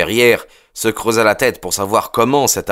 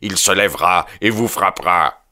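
mild yelled threat in character; prophesy vengeance with force; hammered-out warning; yelling; 2 semitones higher than usual. A narrator's reading. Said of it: "hurla le jeune Drebber".

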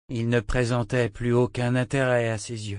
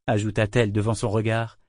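ordinary delivery, nothing marked

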